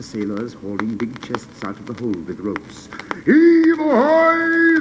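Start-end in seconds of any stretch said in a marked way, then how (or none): 3.2-4.8 very high, loud, drawn-out sailors' call; shout. Acted. Sailors hauling chests, loud high shout. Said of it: "they shouted".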